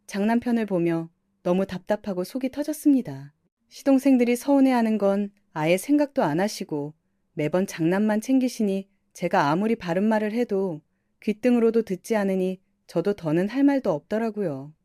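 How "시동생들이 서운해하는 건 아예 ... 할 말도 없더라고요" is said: ordinary delivery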